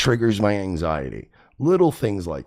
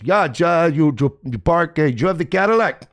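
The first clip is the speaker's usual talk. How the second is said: high, loud mock Cuban accent; 8 semitones higher, 5 dB louder than usual. Stranger asking about the parked Cadillac, high mock Cuban accent. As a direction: imitate an excitable Cuban guy, pitched up. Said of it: "Fucking Cubans".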